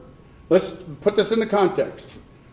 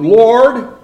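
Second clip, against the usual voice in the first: loud cry; 5 semitones higher, 10 dB louder than usual. Retold or imitated. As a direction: Lord called out loud. Martha calls out to Jesus.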